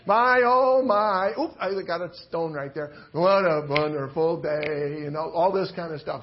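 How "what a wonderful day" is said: ordinary talk, nothing marked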